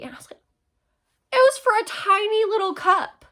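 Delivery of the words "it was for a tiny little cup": high, loud squeal of disbelief; mock outrage. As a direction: squeal it high, appalled at the price. Retelling outrage at drink price.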